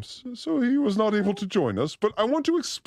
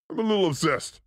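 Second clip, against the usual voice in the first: normal talking voice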